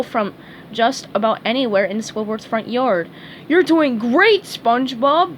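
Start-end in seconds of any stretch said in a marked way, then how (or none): 3.5-5.4 louder, higher cheer; hearty friendly cheer. A narrator's reading. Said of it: "Patrick cheered".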